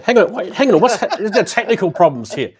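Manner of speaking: loud, high, flustered fake alarm; hammed-up frantic outburst; mock panic. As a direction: pretend the broadcast has gone horribly wrong. Feigned panic over image on screen.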